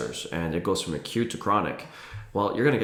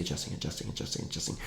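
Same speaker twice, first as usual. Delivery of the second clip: fading to a whisper; 6 semitones lower, 12 dB quieter, much faster than usual. Shown as a demonstration. Fading repetition of adjusting.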